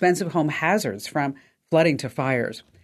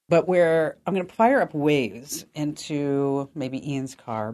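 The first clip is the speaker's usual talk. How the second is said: ordinary delivery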